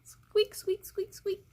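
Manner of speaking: high, fading squeaks; mouse squeaks. Pretend.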